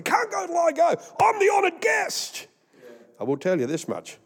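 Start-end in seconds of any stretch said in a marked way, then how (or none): 0.0-2.5 very high falsetto crowing; mock-triumphant high cry; gleeful boast. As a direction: crow it out in a hammed-up squeal, stretching the end. Gloating as honoured guest over sleepers.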